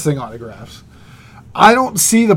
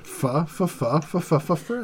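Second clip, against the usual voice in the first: stuttering whisper-soft fresh; 11 dB quieter, slower than usual. Pretend.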